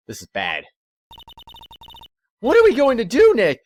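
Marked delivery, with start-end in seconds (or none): 2.4-3.6 put-on high, loud worried girl's voice; worried Maya. Maya asking Nick what to do, high worried voice. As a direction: plead for an answer in alarm.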